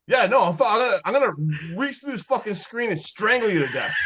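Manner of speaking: mock fury, gritted threat; fake-furious threat to a friend. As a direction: play up the rage at him. Mock rage at co-host eating.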